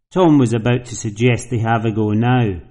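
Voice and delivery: ordinary delivery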